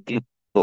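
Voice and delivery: দ্বিত্ব as "dit-to"; pronunciation demo. Shown as a demonstration. Teacher showing learners how দ্বিত্ব is said, pronunciation demo. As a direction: silent first ব, doubled t in দ্বিত্ব. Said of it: "এটা হবে".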